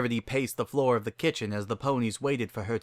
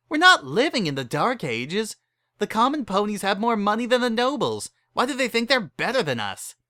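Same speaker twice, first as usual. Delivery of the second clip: indignant Berry Punch; 10 semitones higher than usual. Acted. Berry Punch protests the nobles, indignant. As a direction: protest it, voice rising.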